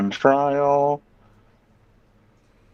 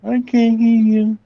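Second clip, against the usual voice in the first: much higher, louder mock deaf-woman voice; hammed-up imitation of a deaf speaker, pitched way up; Mary Matlin impression; 11 semitones higher, 4 dB louder than usual. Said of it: "Yeah, like Mary Matlin".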